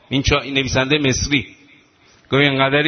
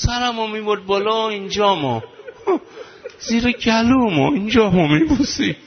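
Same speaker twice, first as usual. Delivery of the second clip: tearful; 6 semitones higher than usual. Acted. Child telling, preacher breaks into weeping.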